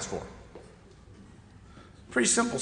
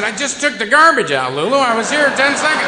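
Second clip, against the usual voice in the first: exasperated reply to the dog; 8 semitones higher, 10 dB louder than usual. Retold or imitated. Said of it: "And I said".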